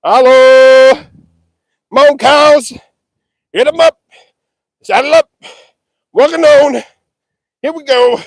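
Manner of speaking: loud high cattle calls; shouting to the cows. Real, not acted. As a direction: holler anything to get them moving. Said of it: "Just shouting random things".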